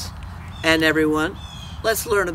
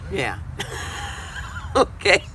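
plain reading voice

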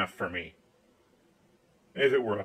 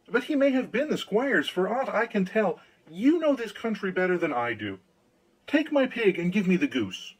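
raised pitch, anxious pleading; frightened plea; 6 semitones higher than usual. Acted. Hans begging the countryman for help, lightly frightened. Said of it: "Poor Hans was sadly frightened".

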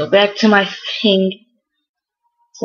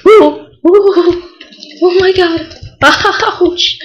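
high, loud panicked yelps; startled yelps; 10 semitones higher, 4 dB louder, much slower than usual. Real, not acted. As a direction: yelp as the explosions hit. Creepers exploding around in game.

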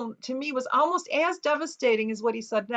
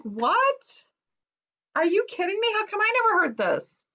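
high, incredulous what, are you kidding; shocked disbelief; 9 semitones higher than usual. Retold or imitated. Daughter reacting to family hearing the rumor, high shocked disbelief. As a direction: pitch it up high in stunned disbelief. Stunned reply to family's admission.

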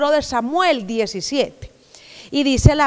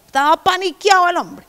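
ordinary talk

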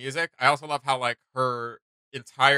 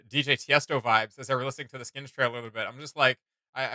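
usual speaking voice

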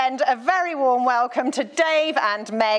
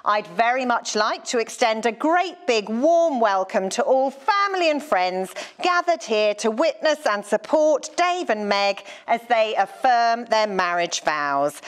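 ordinary talk

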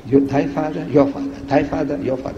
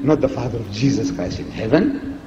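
ordinary delivery

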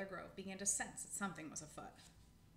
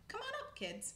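high, raised rallying call; cheerily herd them up the tree; calling the kids. Acted. Squirrel calls pups up to the new camp, rallying call.

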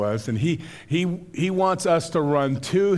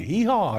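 a higher donkey bray, hee-haw; imitating a donkey; 6 semitones higher than usual. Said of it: "we'd do the noises".

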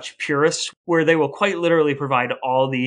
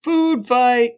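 mock battle cry; 9 semitones higher, 4 dB louder than usual. Joking cry of food fight.

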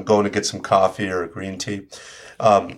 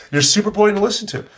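higher, punchier take on boring; animated higher retelling; lively repeat; 8 semitones higher, faster than usual. Shown as a demonstration. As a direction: lift it with energy.

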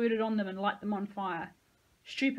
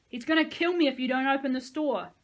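louder, higher begging; desperate plea; 5 semitones higher, 8 dB louder than usual. A narrator's reading. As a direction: beg them to open. Hostage pleading through gate.

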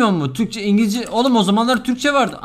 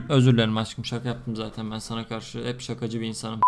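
low, soft placating voice; sheepish apology; 9 semitones lower, 6 dB quieter than usual. Real, not acted. Streamer apologising to sweetheart for joking, soft placating. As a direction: back down quietly and soothe.

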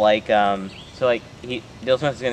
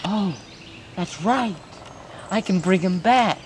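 higher put-on cartoon voice; 8 semitones higher than usual. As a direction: gloat in a squeaky cartoon voice. Continues cartoon voice.

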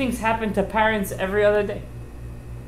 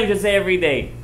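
usual speaking voice

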